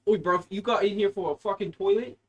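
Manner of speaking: put-on a rough London accent, brief and jokey; "Oi, bruv" in a put-on English accent. Asked by his friend to do an inmate in an English accent. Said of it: "do it with an English accent".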